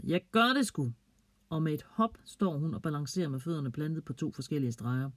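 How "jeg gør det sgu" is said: sudden bold outburst, higher; exclaiming. A narrator's reading. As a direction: blurt it out, daring. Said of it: "Ida pludselig udbryder".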